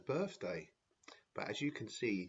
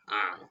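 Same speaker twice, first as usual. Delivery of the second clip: louder gloating laugh; evil laugh; 8 dB louder than usual. Acted. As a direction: chuckle with nasty glee. Villain laughing.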